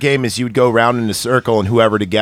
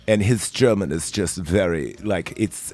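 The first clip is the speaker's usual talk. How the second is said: put-on British accent; 4 semitones lower, 7 dB quieter than usual. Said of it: "has a very, very British accent".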